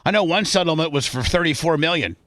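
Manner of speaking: normal talking voice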